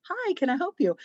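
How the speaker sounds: lightly imitated clerk, high and chirpy; eager desk clerk. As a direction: mimic a keen hotel clerk, pitched high. Imitating the front desk man.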